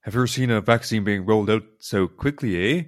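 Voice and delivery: Canadian imitation with a rising "eh"; mock Canadian accent. Repeating the colleague's question for laughs, mock Canadian accent. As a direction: redo it in a playful Canadian accent. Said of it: "My Canadian accent needs some work".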